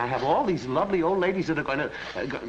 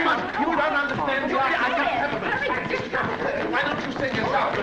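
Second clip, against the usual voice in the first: panicked refusal; 12 semitones higher, 4 dB louder than usual. Acted. Singer refuses, voice gone.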